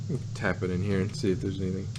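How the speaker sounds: ordinary delivery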